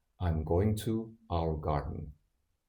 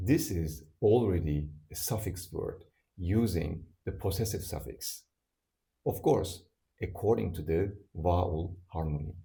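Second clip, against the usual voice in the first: usual speaking voice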